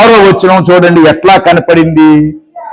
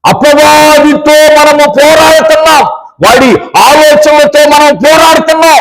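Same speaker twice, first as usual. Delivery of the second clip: fervent preaching shout; 6 semitones higher than usual. Real, not acted. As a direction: preach it with full force to the crowd. Preacher rousing the congregation.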